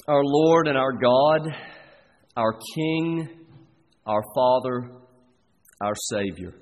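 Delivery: slow, reverent prayer voice with long pauses; closing prayer. Acted. Pastor leading the congregation in prayer, slow and reverent. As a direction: lift it up to God, unhurried.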